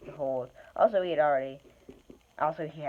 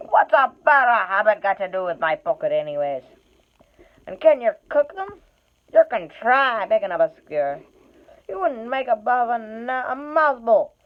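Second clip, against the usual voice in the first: loud, gruff troll arguing; 7 semitones higher, 9 dB louder than usual. Acted. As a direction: trolls bickering over the catch. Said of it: "said William".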